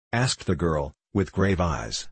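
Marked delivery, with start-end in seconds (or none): none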